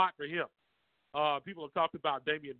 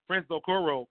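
ordinary talk

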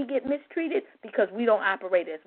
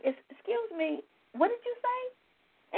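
puzzled stranger; 12 semitones higher, 6 dB quieter than usual. Retold or imitated. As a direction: play a stranger who can't believe what he heard.

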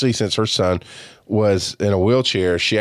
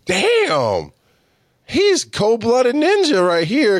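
amazed aside; 13 semitones higher, much slower than usual. Retold or imitated. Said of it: "And I'm like".

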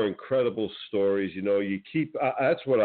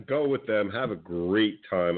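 normal talking voice

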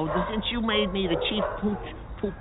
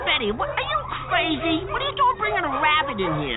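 much higher, panicked outburst; frantic alarm; 9 semitones higher than usual. Acted. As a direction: freak out about the rabbit. Rabbit brought into dog salon.

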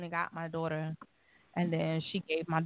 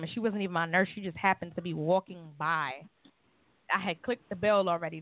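normal talking voice

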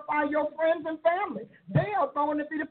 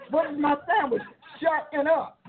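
ordinary talk, nothing marked

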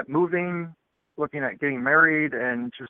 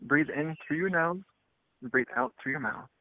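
guided meditation; 11 dB quieter than usual. Acted.

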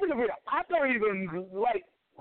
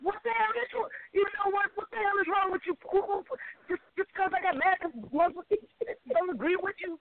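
high-pitched, exasperated confrontation; real mad; 6 semitones higher, 3 dB quieter than usual. Real, not acted. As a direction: demand an answer, genuinely mad, pitch raised. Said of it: "and you getting mad".

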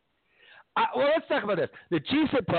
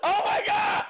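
exasperated shriek. Real, not acted. Reacting to the Rock answer.

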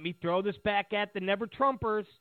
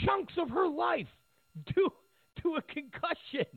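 pitched much higher, in disbelief; incredulous repeat; 9 semitones higher than usual. Real, not acted. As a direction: say it like you can't believe it.

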